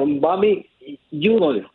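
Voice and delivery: Bobby Udall said with the stress on the first U of Udall. Shown as a demonstration. Caller teaching the lawyer's name, stress on U. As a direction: lean on the opening U of Udall. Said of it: "con acento en la U".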